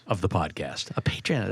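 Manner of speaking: unvoiced whispered aside. Real, not acted. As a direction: breathe it out as a whisper.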